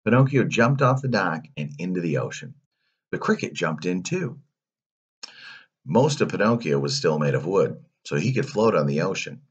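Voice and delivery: ordinary delivery, nothing marked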